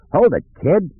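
restrained shout of alarm; urgent shout. A narrator's reading. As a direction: call out urgently, pitch thrown high. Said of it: "he shouted".

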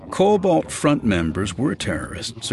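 plain reading voice

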